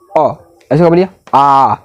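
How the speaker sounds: अ said short, then आ drawn out long; short vs long vowel. Shown as a demonstration. Teacher contrasting अ and आ lengths for class. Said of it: "देखिए इसको बोलिए और इसको बोलिए".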